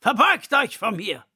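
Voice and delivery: lion bellowing an order; commanding lion. A narrator's reading. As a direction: order them to bow down. Lion commands them to bow.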